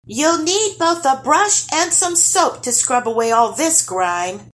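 insisting. Acted. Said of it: "insisted Nils".

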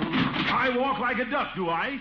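slow, rising huff of outrage; indignant. Real, not acted. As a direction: bristle at the insult. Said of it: "Why, that little".

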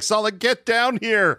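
high, drawn-out mock summons; retold calling out. Film fan mimics Indy summoning his friend, calling out.

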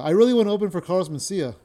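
loud, fast joke delivery; stage delivery. Shown as a demonstration. Performing his new joke for viewers, loud. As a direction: punch it out like on stage. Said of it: "my joke is this".

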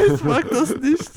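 high, giggling through the words; mocking the stop rule while laughing. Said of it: "„Stopp, das mag ich nicht".

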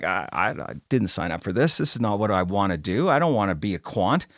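low, soft reluctant grumble; quiet whiny complaint. Retold or imitated. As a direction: mutter it like a worn-out leader. Imagined reluctant revenue leader complains.

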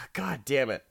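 usual speaking voice

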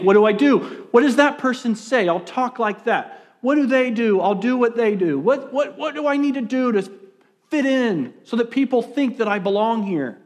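high, fretful string of questions; anxious inner voice. Acted. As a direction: act out someone desperate to fit in. Acting out anxious inner thoughts.

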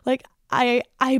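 ordinary talk

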